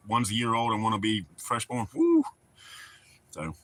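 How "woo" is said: quick high-pitched "woo"; short, high exclamation; high whoop. Real, not acted. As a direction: let out a high woo at the thought. Exclaims at two babies coming.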